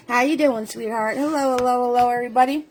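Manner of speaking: ordinary delivery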